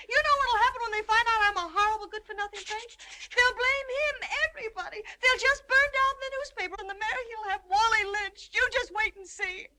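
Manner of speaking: fast, loud, high hysterical rant; screwball comedy banter. Acted. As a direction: work yourself into hysterics. Hysterical rant about lynching.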